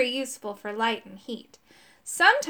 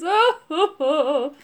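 loud, high, acted wailing sobs; hammed-up werewolf boo-hoo; bursting into tears; 5 semitones higher, 6 dB louder, slower than usual. A narrator's reading. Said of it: "Then it burst into tears".

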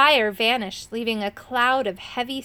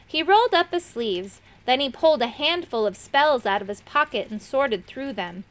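ordinary delivery, nothing marked

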